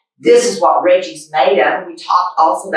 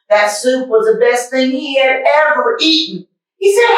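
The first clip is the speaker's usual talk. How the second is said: lively picture-book read-aloud; reading a story to kids; 3 semitones higher than usual. A narrator's reading. Reading a picture book to boys and girls, lively storytime voice. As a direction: read it like storytime for little ones.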